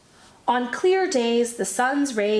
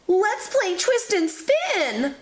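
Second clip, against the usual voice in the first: shouted; 9 semitones higher, slower than usual. Acted. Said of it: "Phil shouted".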